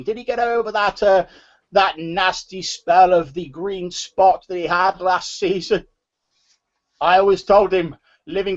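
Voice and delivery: put-on high old-man voice, halting at first. Old man asking after the youngster's father, high chatty old-man voice. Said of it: "the voice of an old man".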